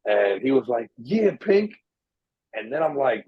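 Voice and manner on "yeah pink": high-pitched imitation of a taunt; mock heckle. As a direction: call it out high, rising on "pink". Retold heckle about his pink shirt.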